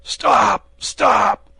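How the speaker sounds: very loud, high panicked cry; terrified shout. A narrator's reading. Wounded bandit cries out in terror at the fierce gesture. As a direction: shriek to stop the blade. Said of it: "cried he".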